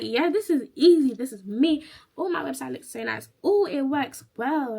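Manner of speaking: loud, high, swooping boast; gleeful confidence. Retold or imitated. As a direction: play up how easy it felt. Acts out finding exam easy.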